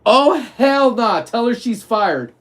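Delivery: a hint of an outraged boss; lightly voiced indignant boss; quoting the boss. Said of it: "and I quote".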